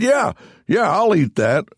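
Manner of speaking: grab the offer gleefully; eager yes. Retold or imitated. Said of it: "And I said".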